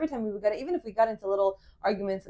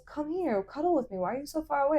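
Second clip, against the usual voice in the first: higher-pitched cuddly coaxing, imitated; lilting, coaxing mock-boyfriend voice; being soft; 5 semitones higher than usual. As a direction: imitate a boyfriend sweetly making up. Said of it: "now that he's being soft".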